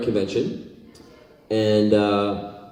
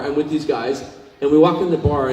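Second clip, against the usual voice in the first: ordinary delivery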